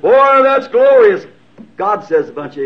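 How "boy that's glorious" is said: mock pompous praise. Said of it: "the great high church said".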